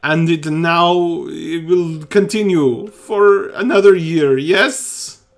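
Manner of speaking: loud, high pleading; frantic plea. Acted. Priest begging the party to pray for his boy, loud and high. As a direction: beg loudly, near breaking.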